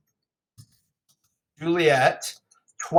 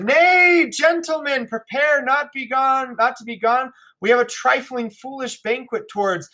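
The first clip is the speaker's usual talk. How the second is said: put-on much higher, hearty old host voice; jolly host Capulet; 6 semitones higher than usual.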